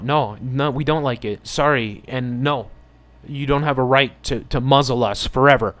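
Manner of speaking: loud, rising defiance that builds to the end; emotional outburst. Real, not acted. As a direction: push back hard against being told to wear masks forever. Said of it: "I'm getting emotional".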